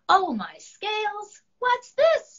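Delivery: high, bright surprised dragon; high surprised dragon exclaiming; excited dragon voice. Acted. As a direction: gasp it out as an amazed little dragon. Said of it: "Dragon asked".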